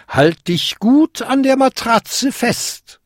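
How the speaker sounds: high, slow, emphatic command; instructing old lady. A narrator's reading. Old lady before the spell, emphatic instruction. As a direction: warn him to hang on.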